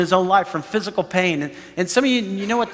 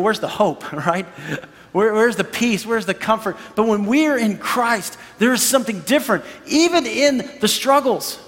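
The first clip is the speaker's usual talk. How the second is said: ordinary delivery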